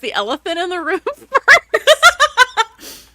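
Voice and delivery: giggling through the question, slow; laughing. Real, not acted. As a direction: laugh while you ask.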